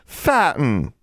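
speaking up. A narrator's reading. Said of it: "more loudly".

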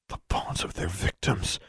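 hushed, half-whispered thought; softly, as a dark realisation to oneself; inner thought. A narrator's reading. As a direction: think it under your breath. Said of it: "Alexandra thought".

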